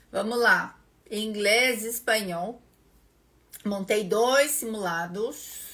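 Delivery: normal talking voice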